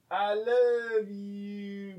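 mock stilted love line; bad wooden acting. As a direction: read it like a bad actor. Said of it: "really bad at acting".

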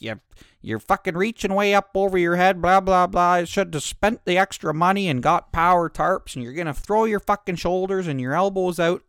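mock-nagging trucker; lecturing old trucker. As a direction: imitate the old man's know-it-all lecture. Trucker lecturing about tarps.

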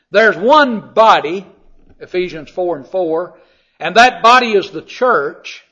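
slow, loud, weighty pulpit emphasis; emphatic preaching. Acted. Preacher insists to the congregation that there is one church, slow and loud. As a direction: drive home "one body".

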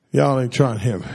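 ordinary delivery

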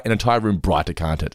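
ordinary talk

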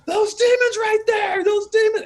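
very high, loud, drawn-out scared shout; yelled line reading. Shown as a demonstration. Director showing actor how scary demons are, alarmed yell. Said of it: "You have to be like".

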